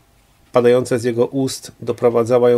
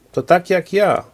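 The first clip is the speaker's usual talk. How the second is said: higher, cynical throwaway; cynical reply; 8 semitones higher than usual. A narrator's reading. Husband answering his wife's disgust cynically. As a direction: toss it back cynically. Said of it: "odpowiedział cynicznie".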